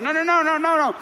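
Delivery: high, loud panicked no no no; frantic shouting. Retold or imitated. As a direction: yell at him to stop, high and loud. Panicking woman stops his jump.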